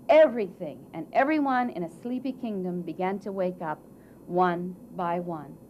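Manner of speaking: ordinary delivery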